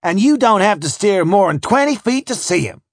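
loud, high eager overseer call; crying out. Acted. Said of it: "cries the infernal clod".

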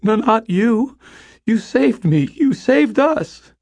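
loud, very high anguished protest; high, impassioned plea; distraught pleading. A narrator's reading. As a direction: cry it out in desperate disbelief. Betrayed boy pleads with his guardian.

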